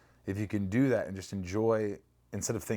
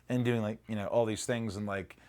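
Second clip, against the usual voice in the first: ordinary delivery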